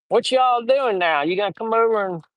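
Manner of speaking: hammed-up Southern invitation; Southern accent. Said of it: "I'd impersonate Southerners".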